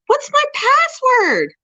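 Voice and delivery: very high, slow whine; whiny plea. Real, not acted. Streamer stuck at login, whining at her forgotten password. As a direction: drag it out like a hopeless plea. Can't log in.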